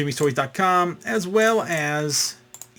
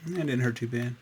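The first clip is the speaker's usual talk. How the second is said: ordinary talk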